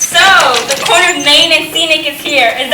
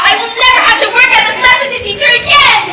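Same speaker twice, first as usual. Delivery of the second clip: gloating villain; 8 semitones higher than usual. Acted.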